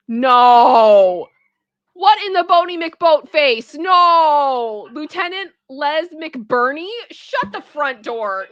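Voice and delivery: loud, stunned protest at a ridiculous name; loud incredulous outburst; outraged disbelief. Real, not acted. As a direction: shout it down in disbelief. Outraged reaction to a silly name.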